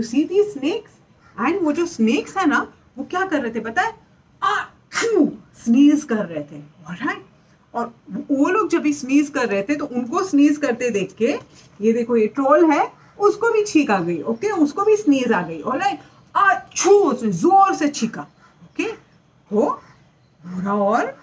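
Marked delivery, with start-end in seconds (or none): 4.4-5.3 high, pretend sneeze sound; playful mock achoo. Snakes sneezing in story. 16.4-16.9 high, pretend sneeze for kids; mock troll sneeze; loud sneeze. Troll sneezes loudly in story.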